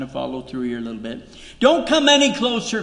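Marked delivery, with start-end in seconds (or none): 1.6-2.8 much higher and louder, a sharp command; commanding warning. Acted. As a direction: stop him in his tracks. God warns Moses at bush.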